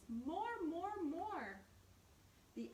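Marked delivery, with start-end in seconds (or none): 0.1-1.6 high cheering chant from the crowd. Acted. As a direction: egg the bear on like fans wanting an encore. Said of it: "cheered the audience".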